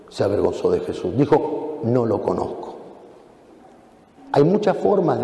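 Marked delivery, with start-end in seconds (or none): 1.8-2.7 lower, softer, ashamed; low quiet denial. Acted. Peter's last denial, low and ashamed. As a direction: mutter the denial in shame.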